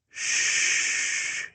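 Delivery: soft whispered shh; soothing shush. A narrator's reading. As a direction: hush her gently like a frightened child. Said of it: "he soothed".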